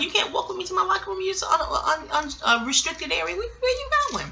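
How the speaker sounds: high-pitched, incredulous objection; retold protest. Officer stopping the man following her to the locker room, high flustered protest.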